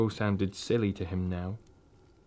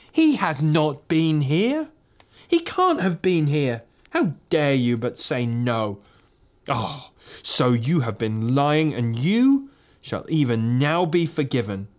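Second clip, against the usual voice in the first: furious witch; 4 semitones higher than usual. Acted. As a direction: insist coldly, barely holding rage.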